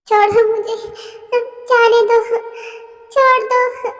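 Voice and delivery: high, loud cries of let go; desperate scream. Acted. Jimin screams at the attacker to let her go, shrieking.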